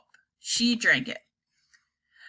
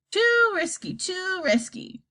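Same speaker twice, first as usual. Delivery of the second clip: put-on high, slow croak of a grumpy old granny; croaking old woman; 9 semitones higher, slower than usual. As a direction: croak it out like a cranky old grandma. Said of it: "croaked Grandma Georgina".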